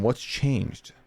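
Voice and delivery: ordinary delivery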